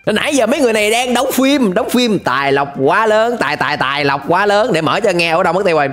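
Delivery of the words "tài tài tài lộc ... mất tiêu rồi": ordinary delivery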